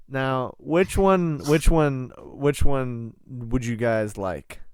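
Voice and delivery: ordinary talk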